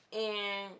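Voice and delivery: ordinary delivery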